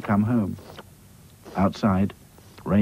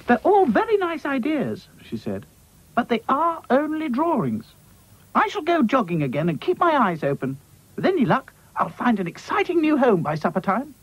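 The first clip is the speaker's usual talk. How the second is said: Dotty's voice. Acted. Dotty speaks.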